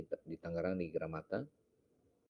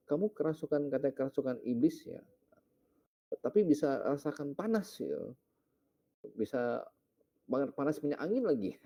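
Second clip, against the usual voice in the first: higher, mock-incredulous teasing; teasing rebuke; 7 semitones higher than usual. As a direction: poke fun at him. Pastor teases the pretender.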